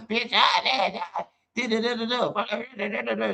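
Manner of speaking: high babbling mock rant; a high mock rant of nonsense syllables; mock cursing rant. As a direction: squeal it like someone cursing all day. Mocking people cursing all day.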